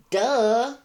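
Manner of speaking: high, loud mocking "Duh"; sassy duh. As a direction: toss off a scornful duh. Mocking quoted post, sassy.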